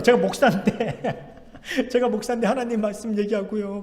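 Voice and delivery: laughing through the words, amused; amused pastor laughing mid-sentence. Real, not acted. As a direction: chuckle as you tell it, can't believe it. Laughs at being evangelized as pastor.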